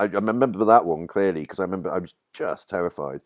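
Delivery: ordinary talk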